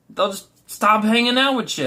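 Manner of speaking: ordinary delivery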